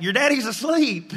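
a lightly voiced mother calling across the house; mom calling back. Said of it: "the mom screams back".

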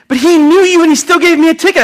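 high, loud aggrieved protest; student complaining to her teacher, high and outraged; indignant girl. Retold or imitated. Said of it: "He said".

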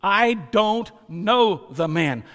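loud, slow, emphatic; a forceful sworn denial; denial with an oath. Shown as a demonstration. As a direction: swear it out, word by word. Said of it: "with an oath".

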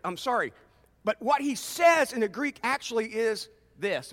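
ordinary talk

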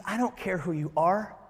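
softly spoken, unimpressed reassurance; hushed, matter-of-fact answer; quiet dismissal. Retold or imitated. God's calm answer to Gideon.

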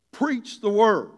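booming imperative from the pulpit; emphatic command. Shown as a demonstration. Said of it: "it is with that kind of emphasis".

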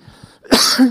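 sudden loud cough mid-sentence; a real cough breaking the sentence; coughing fit. Real, not acted. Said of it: "I am so sorry I'm coughing so much".

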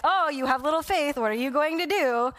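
a hint of a scornful scold; mock scolding. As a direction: play the harsh version he doesn't do. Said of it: "versus like".

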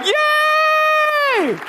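high, loud gleeful yay; angels cheering. Retold or imitated. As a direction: shout it like heaven's party started. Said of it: "And the angels are like".

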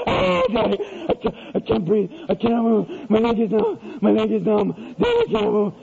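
imitated gasping, wailing child; hysterical crying. Son begs Daddy before spanking, mock hysterical crying. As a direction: sob and choke out the words, hammed up. Child sobs that he cannot move.

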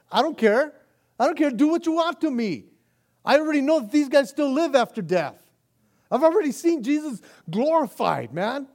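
loud, high, ringing defiance; fearless Peter. Retold or imitated. Peter telling the council to do their worst, shouting. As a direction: cry it out with nothing to lose.

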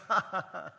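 mock chuckle; light, knowing "ha ha ha"; retold laugh. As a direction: laugh it off as a joke. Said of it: "And so I said".

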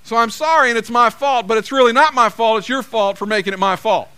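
hammed-up defensive apology; mock excuse apology. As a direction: blame them while pretending to apologize. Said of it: "something that sounds something like this".

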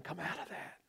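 soft, hushed whisper; gentle whisper. Retold or imitated. Preacher voicing the Holy Spirit's gentle whisper, hushed. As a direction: breathe it gently. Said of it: "gentle whisper of the Holy Spirit".

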